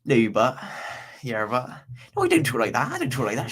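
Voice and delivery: mock Bristolian chav accent, jumpy pitch; sketch-show chav accent, pitch leaping up; Vicky Pollard. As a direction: put on a protesting West Country chav accent. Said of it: "a very Vicky Pollard accent".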